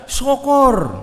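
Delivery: very high, loud sneering "Syukur"; mocking gloat. Imagined spiteful Jesus gloating at empty nets, shrill. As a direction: gloat over their failure. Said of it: "kalau Tuhan Yesus ngomongnya".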